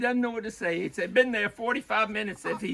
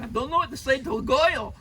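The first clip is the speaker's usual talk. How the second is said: louder, high-pitched New York accent; mock New York accent, pitched up; 9 semitones higher, 6 dB louder than usual. Shown as a demonstration. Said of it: "maybe in a New York accent".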